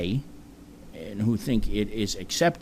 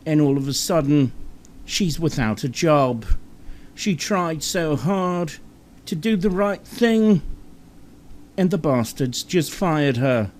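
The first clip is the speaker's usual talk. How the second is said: dramatic British reporter; 7 semitones higher than usual. Acted. Host parodying BBC coverage of a fast-food story, overdramatic British reporter. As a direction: report it like the end of the world. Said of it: "it would sound sort of like".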